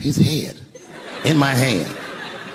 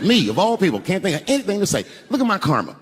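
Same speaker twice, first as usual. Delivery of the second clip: normal talking voice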